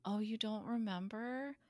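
softly voiced, careful question; hushed retold line; quiet acted quote. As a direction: drop to a soft, tentative voice. Acted quote of gentle, concerned friend.

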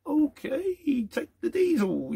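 very high, drawn-out pleading squeak; mock meek surrender. Driver facing three burly diesel thieves, mock meek surrender. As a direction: give in slowly in a high, wavering mock voice.

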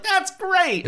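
high, bright fake praise; gushing friend. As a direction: gush like a polite friend. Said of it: "they were like".

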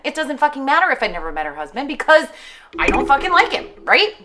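working herself into a loud rant; heated rant. Real, not acted. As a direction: pitch shoots up as the rant builds. Ranting about husband.

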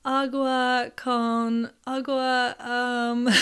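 slow, halting learner's Spanish; imitating a non-fluent Spanish attempt; broken Spanish. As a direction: grope slowly through broken Spanish. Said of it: "very broken Spanish".

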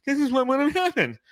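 high mocking fan voice; whiny know-it-all taunt; mock Nuggets fan. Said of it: "You're gonna be like".

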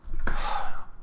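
heavy unvoiced sigh. Speaker bracing to keep going after rock bottom, sighing. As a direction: let out a big breath. Making herself say okay, psyching up.

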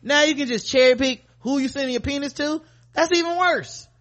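high, outraged disbelief. Real, not acted. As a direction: pitch rising in outrage. Outraged rant.

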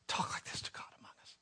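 hushed, awed whisper; a stunned whisper to the congregation. Real, not acted. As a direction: breathe it, almost afraid to say it. Awed aside after the psalm.

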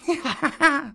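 high snake chuckle; a slow amused chuckle. A narrator's reading. Kaa chuckling at the cobras, amused. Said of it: "said Kaa with a chuckle".

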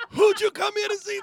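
high, full-voiced MC holler; hype shout. Kid behind the speaker asking who they came to see, hype shout. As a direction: imitate an emcee yelling to rile up a crowd. Kid works up crowd again.

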